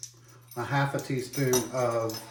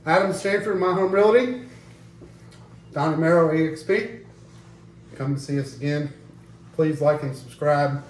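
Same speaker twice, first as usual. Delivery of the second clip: loud, upbeat channel outro; vlog sign-off; 3 semitones higher, 6 dB louder than usual. Acted. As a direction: hype up the sponsors. Host's channel sign-off shout-outs.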